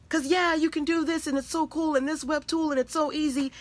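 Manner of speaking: high, gushing tech cheerleader; mock enthusiasm. Teacher parodying easy web-tool talk to her audience, mock enthusiasm. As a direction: pitch it way up, imitating breezy hype. Voicing breezy, overeager chatter.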